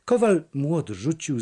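ordinary delivery, nothing marked

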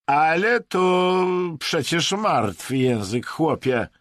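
loud, high, drawn-out dismissal; dismissive. A narrator's reading. Vadim brushes off Latin as useless, dismissively. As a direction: wave it off loudly, stretching the words. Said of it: "rzucił lekceważąco".

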